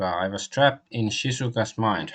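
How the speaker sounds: usual speaking voice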